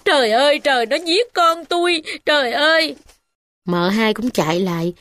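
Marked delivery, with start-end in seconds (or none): 0.0-3.0 cry out. A narrator's reading. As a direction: wail in horror over her son. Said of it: "bà chạy lại la rằng".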